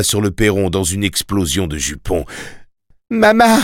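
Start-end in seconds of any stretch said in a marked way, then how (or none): 3.1-3.6 loud, very high cry for mother; child's piercing yell; boy's yell. A narrator's reading. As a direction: scream it out for your mother. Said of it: "hurla Tim".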